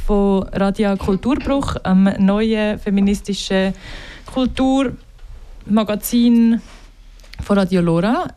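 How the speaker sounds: Swiss German dialect welcome. Real, not acted. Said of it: "jetzt wechsle ich zum Schweizerdeutsch".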